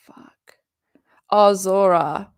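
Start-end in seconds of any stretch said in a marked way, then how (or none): 0.1-0.6 breathy whisper under the breath; real muttered whisper of frustration; whispered curse. Real, not acted. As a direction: hide the swear from the mic. Cursing under breath on stream.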